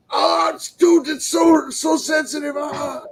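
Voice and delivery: high-pitched mock cries of pain; freaking out in pain. Friend reacts as his sore hand is examined, freaking out. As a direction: wail like someone poking your sore wound. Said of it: "he's freaking out".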